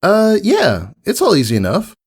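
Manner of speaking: shrugging it off; casual Scootaloo. A narrator's reading. Voices Scootaloo.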